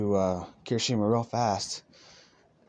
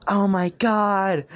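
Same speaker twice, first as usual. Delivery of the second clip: high, loud, stretched-out squeal; hammed-up emotional outburst; overwhelmed amazement; 10 semitones higher, 7 dB louder, slower than usual. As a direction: draw it out slowly, gushing with disbelief. Same friend still amazed.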